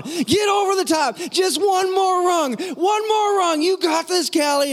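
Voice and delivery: very high, stretched-out cheering; yelling encouragement. Retold or imitated. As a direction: holler like a dad the whole park hears. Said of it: "now I'm yelling louder than that".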